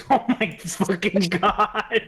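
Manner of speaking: slow, laughing disbelief. Real, not acted. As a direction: laugh through it. Laughing at the perk choice.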